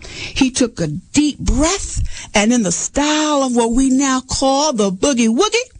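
usual speaking voice

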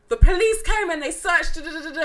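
high-pitched retold complaint; mock irritation, trailing into da-da filler; imitating annoyed girlfriend. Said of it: "she's clearly annoyed".

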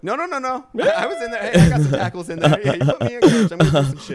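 high, loud imitation of an eager co-host; mock eager protest. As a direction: pipe up like a player begging for credit.

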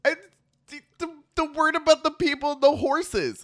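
high, loud, panicky stammer; flustered child. Retold or imitated. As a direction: scramble to explain, caught out. Flustered child explaining herself.

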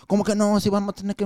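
put-on sauced-up Spanish accent. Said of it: "he would just sauce it up".

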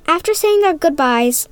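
ordinary delivery, nothing marked

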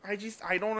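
put-on broad Chicago accent, baffled; mock Chicago man complaining. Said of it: "like, this big guy from Chicago".

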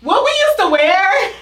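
high, loud, drawn-out embarrassed wail; cringing exclamation. Real, not acted. Woman recalling her old club outfits, cringing exclamation.

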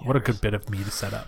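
sarcastic whisper. Real, not acted. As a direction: whisper it, dripping irony.

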